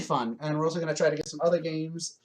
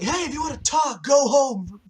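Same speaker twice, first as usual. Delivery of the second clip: high, shouted rebuke; mock shout from the back row; shushing shout; 9 semitones higher than usual. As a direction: yell at the talkers to go home. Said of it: "and they're like".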